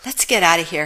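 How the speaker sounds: usual speaking voice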